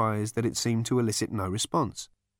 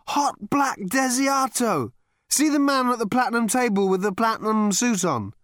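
put-on drunk Ford; 13 semitones higher, 5 dB louder than usual. A narrator's reading. Drunk Ford voiced by narrator.

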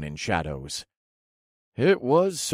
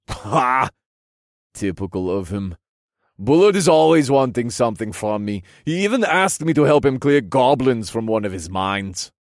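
loud, indignant royal voice; scoff, then complain with rising force; regal king, scornful; 4 semitones higher, 6 dB louder than usual. Acted. King complaining about Duke Bullard, scornful loud voice.

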